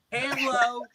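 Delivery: high, put-on hello; put-on phone greeting. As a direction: answer in a silly high voice. Voices himself answering scam call.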